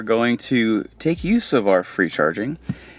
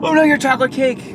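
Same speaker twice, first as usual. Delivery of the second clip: surprised; 3 dB louder, faster than usual. Real, not acted. Cake spills during launch.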